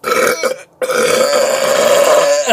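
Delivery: rough hacking cough; harsh cough. Real, not acted. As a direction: cough hard and loud. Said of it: "that sounded terrible".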